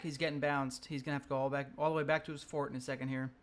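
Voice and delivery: normal talking voice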